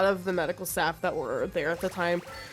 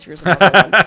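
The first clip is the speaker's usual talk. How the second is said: laughing quote; 3 semitones lower, 12 dB louder, faster than usual. Retold or imitated. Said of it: "She's like".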